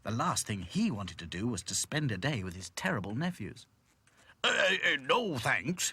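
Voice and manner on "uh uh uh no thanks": loud, higher spluttered refusal; spluttering. A narrator's reading. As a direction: stammer out a flustered refusal. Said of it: "he spluttered".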